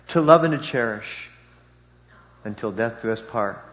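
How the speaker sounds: solemn vow recitation; saying wedding vows. Shown as a demonstration.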